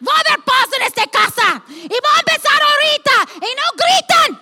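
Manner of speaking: put-on high, loud, fast yelling at the kids; shouting mom. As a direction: bellow for peace in the house. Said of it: "Y yo entro y digo".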